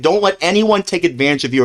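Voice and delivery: ordinary talk, nothing marked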